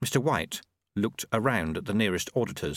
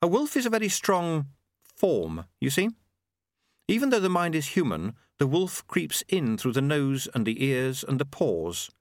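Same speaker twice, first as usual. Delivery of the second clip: higher-pitched explaining character; instructive Susan; 6 semitones higher than usual. A narrator's reading. Susan explaining werewolves to Lobsang, instructive. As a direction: lay it out in a higher voice.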